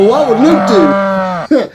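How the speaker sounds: slow, drawn-out, theatrical "what would Luke do"; drawn-out title. Shown as a demonstration. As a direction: stretch the title out slowly like an announcement. Repeats the show title dramatically.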